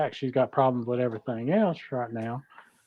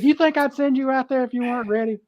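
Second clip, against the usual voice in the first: imitating coach; 10 semitones higher, 4 dB louder than usual. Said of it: "he said".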